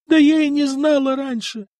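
high, loud tearful outburst, lightly acted; bursting into tears. A narrator's reading. The old woman answers the envoy, a light tearful outburst, high and loud. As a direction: break into a lightly voiced sobbing cry. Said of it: "разрыдалась милица".

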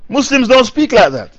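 usual speaking voice

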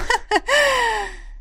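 high peal of laughter; ringing laugh. Acted. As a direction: laugh brightly, hiding anger. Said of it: "Заливисто рассмеялась принцесса".

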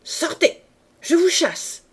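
high loud dismissal; shouted. A narrator's reading. Mistress dismisses the maid, high loud shout. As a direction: fling her out with a shout. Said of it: "cria madame Josserand".

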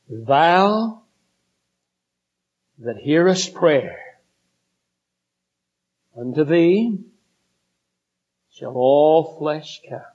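slow, measured reading of a psalm verse; solemn scripture reading. Acted. Preacher reading the psalm to the congregation, slow and solemn. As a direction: lift the old words out slowly and reverently.

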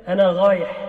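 أنا غايح with the r said as a gh sound; lisp r as gh. Shown as a demonstration. Teacher demonstrating a lisp: r turned into gh. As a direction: say غايح with a throaty gh for r. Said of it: "يعني مثلا يقول".

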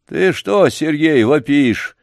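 put-on a touch of an old man's displeasure; displeased. A narrator's reading. Grandfather woken by the boy's yelling, displeased. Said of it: "недовольно спросил Ладышкин".

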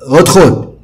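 calling out loudly. A narrator's reading. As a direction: shout come in through the door. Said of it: "قلت بصوت عالي".

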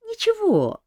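put-on high young woman's voice, reassuring; consoling. A narrator's reading. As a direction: soothe him lightly as a girl. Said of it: "утешила его Ольга".

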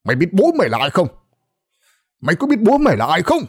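fast, rising angry rant. A narrator's reading. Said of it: "không ngừng chửi". (lão Tuất chửi không ngừng, giọng cao dần)

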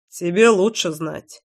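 mildly gloomy, slow reply. A narrator's reading. Friend answers her question about the man, mildly gloomy. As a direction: answer a touch sullenly, taking your time. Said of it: "хмуро ответил кирпич".

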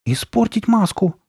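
high, drawn-out surprise; incredulous question. A narrator's reading. Sabrina at the idea of cutting the mask, incredulous.

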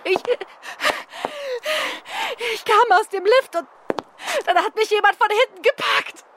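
high, breathless and frightened, words catching; a woman still reeling from being strangled, voice climbing in panic; terrified retelling of the attack, pitched high; shaken after attack. Acted. Victim just attacked, shaken.

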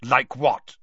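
loud, abrupt challenge; Moody, sharp. A narrator's reading. Moody interrupting Harry's theory, sharp. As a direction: cut in sharply. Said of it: "said Moody sharply".

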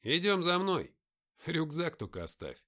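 lightly voiced brisk guide; a hint of a commanding leader; character voice. Character dialogue voiced higher.

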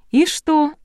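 high, loud astonished question; surprised. A narrator's reading. Stylist dismissing the bride's doubts, surprised. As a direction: pitch leaps in surprise. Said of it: "удивилась я".

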